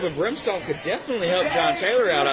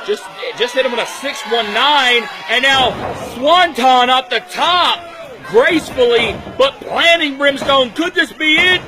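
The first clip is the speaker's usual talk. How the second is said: loud, higher hype over the big dive; wrestling commentator; 5 semitones higher, 4 dB louder than usual. Real, not acted. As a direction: sell the near fall like it's over. Commentator calling dive and near fall.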